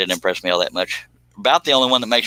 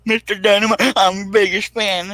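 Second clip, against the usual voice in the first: squeaky fan voice; 11 semitones higher, 3 dB louder than usual. Acted. Host gushing to Jeff Dunham in a high squeaky fan voice.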